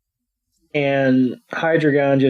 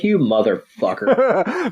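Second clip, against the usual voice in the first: mock outraged retort; 5 semitones higher, slower than usual. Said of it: "I was like".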